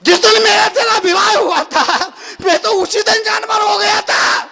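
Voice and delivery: high, loud declaiming husband; shouted punchline. Acted. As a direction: belt out the comic confession. (ऊँची आवाज़ में पति का एलान)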